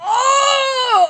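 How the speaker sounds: high pained cry; sudden yelp as it stings; real pain cry. Real, not acted.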